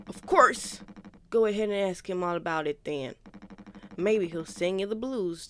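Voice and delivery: lightly voiced crewmate arguing, loud; character voice. Crewmate challenging the new captain, a loud hint of a character. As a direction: read the crewmate's lines with a loud edge. Voicing a game character's dialogue.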